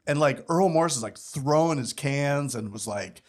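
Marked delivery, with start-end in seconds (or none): none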